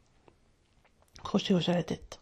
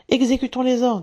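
higher and louder, keen to obey; eager call; 5 semitones higher, 7 dB louder than usual. A narrator's reading. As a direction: shout it like eager soldiers.

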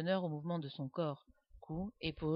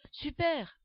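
high, loud beaming praise; delighted; 9 semitones higher, 6 dB louder than usual. A narrator's reading. Doudou praising his friend's story, beaming cheer. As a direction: cheer with a big smile. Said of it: "apprécie Doudou avec un éclatant sourire".

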